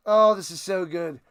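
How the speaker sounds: ordinary delivery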